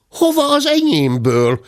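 put-on high, startled little-girl voice; startled child. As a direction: squeak it out in alarm. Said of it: "riadt föl Zebike".

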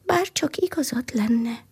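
sighing wish. A narrator's reading. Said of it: "sóhajtott az".

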